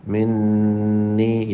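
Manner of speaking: منی with the doubled noon hummed long through the nose; ghunna on noon. Shown as a demonstration. Teacher showing the ghunna in منی. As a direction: hold the nasal noon in منی. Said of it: "یہاں پہ بھی غنہ کریں".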